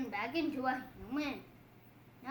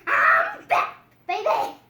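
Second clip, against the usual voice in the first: loud, swooping call; hammed-up victorious cheer; triumphant shout; 6 semitones higher, 14 dB louder, slower than usual. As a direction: announce the comeback. Character triumphant return.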